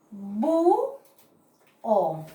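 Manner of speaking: bu, then o, the hiatus split into two syllables. Shown as a demonstration. Teacher sounding out búho for students, syllable by syllable. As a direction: sound out bu and o apart.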